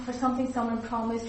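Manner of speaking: normal talking voice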